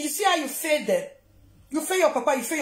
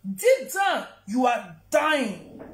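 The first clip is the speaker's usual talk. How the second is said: repeats "Deep down, you are dying" slowly, with "you are dying" drawn out; slow emphatic repeat; 3 semitones lower, much slower than usual. Shown as a demonstration. To the absent mother online, a slow pointed repeat. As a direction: lean on it slowly the second time, letting "dying" land.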